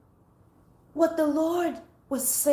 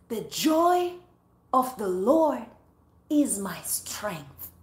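scripture reading; 2 semitones lower, slower than usual. Acted. Quotes scripture slowly.

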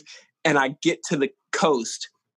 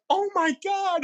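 shocked; slower than usual. Retold or imitated. Thieves shocked he found them.

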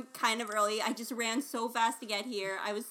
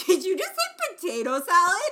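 amused, higher and louder, laughing; 6 semitones higher, 7 dB louder than usual. Real, not acted. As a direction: ask it while cracking up. [name] amused by potato salad.

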